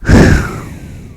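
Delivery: long heavy exasperated sigh; one big audible sigh. Real, not acted. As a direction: let out a big fed-up sigh. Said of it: "that's what that deserved. A big sigh".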